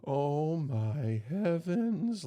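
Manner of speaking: gasp, then a slow drawn-out "oh my heavens"; mom's slow aghast gasp at the wound; shocked gasp. Retold or imitated. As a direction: stretch it out in horror. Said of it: "like that".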